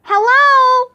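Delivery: high, loud drawn-out holler; yelling. A narrator's reading. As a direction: yell a hello into an echoing gym. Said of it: "I yelled".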